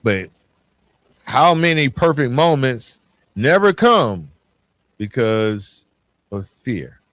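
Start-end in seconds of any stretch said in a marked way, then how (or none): none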